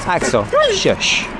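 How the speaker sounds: drawn-out shush to the dog; slow hushing. Real, not acted. Owner slowly hushing his barking dog Axel, drawn out. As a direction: stretch it out to settle him.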